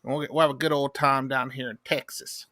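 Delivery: lightly voiced good-ol'-boy Texan; put-on Texas accent. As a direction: play a folksy Texan dad. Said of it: "in that voice".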